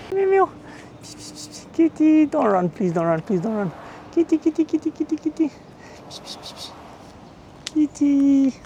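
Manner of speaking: very high sing-song kitty call; cat-calling voice. Real, not acted. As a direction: coax a cat out in a tiny high voice. Coaxing a stray cat.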